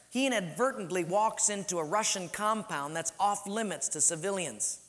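ordinary talk, nothing marked

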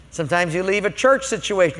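ordinary talk

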